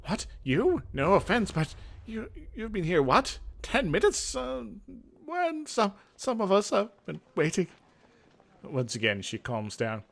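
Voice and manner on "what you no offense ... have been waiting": high, indignant disbelief; startled sorceress protesting at the new hand. Acted. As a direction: scoff at the newcomer's nerve. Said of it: "she looks startled".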